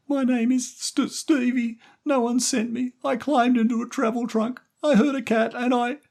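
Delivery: put-on high, loud tearful boy's voice; scared crying boy. A narrator's reading. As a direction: plead through tears as a small boy. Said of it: "Stevie started to cry".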